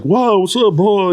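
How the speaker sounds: high-pitched in-character comic greeting; Bill Murray. Retold or imitated. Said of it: "he's always in character".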